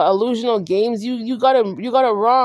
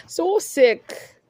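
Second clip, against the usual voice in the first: higher, full-voiced exclamation of disgust; openly disgusted exclamation; 7 semitones higher than usual. Real, not acted. Repeats aside, now aloud.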